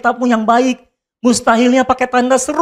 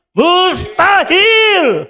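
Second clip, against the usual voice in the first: "Mustahil" shouted, higher and very drawn out; emphatic shout; 8 semitones higher, much slower than usual. Shown as a demonstration. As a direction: stretch "Mustahil" into a long shout. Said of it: "Jawabnya yang tegas".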